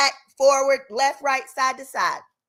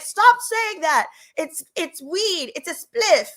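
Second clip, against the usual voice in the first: much higher, slower mock protest; defensive boyfriend; 8 semitones higher, 4 dB louder, much slower than usual. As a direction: play him insisting it's just weed. Boyfriend denying being on drugs.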